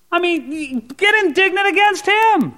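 indignant exhortation. Acted. Urging indignation at devil.

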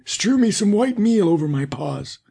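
high put-on wolf voice. A narrator's reading. Wolf asking the miller for meal, high voice. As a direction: request it as the wolf, pitched high. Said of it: "said he".

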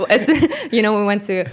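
laughs it off, embarrassed about her Spanish; laughing. Real, not acted. Guest laughing at her own claim of speaking Spanish. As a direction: breaks into a laugh, then talks through it.

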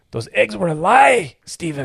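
high, loud, slow hammed-up accusation; mock outrage. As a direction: cry betrayal like a wronged child. Mock outrage over a childhood lie.

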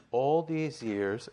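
"all these years" drawn out slowly with a loud, rising "all"; stressed slow quote. Acted. Preacher quoting the resentful older son with heavy emphasis on "all these years". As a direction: lean hard on "all these years" and stretch it. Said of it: "the choice of the word is very important".

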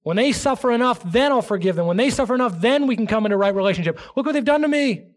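whiny, self-pitying grievance; mock aggrieved voice. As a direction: play the wounded grudge-holder. Preacher voicing the unforgiving heart.

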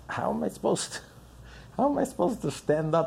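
normal talking voice

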